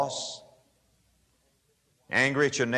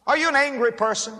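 pressing question; 9 semitones higher than usual. Real, not acted. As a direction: push the question home to the listener. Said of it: "question repeated with more force".

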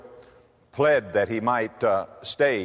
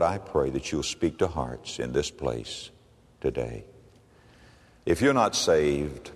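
leading a prayer; 5 semitones lower than usual. Acted. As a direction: pray gently with the congregation. Leads the altar prayer.

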